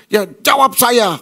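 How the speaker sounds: the son demands an answer from his father, slow and furious; angry demand. Retold or imitated. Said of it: "terjadi pertengkaran".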